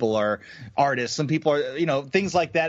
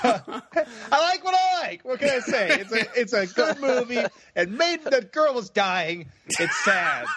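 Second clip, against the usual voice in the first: higher, laughing through the words; giggling mock defensiveness; laughing defense; 8 semitones higher than usual. Real, not acted. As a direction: defend yourself while cracking up. Defending film while laughing.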